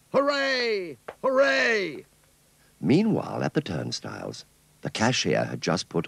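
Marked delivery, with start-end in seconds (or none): 0.1-2.0 very high, squeaky alien hooray; alien cheer. Acted. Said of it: "He cried".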